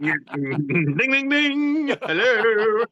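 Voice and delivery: high, singsong bell dings; ringing-bell imitation. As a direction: chime it like a winning bell. Mimes a bell at the point.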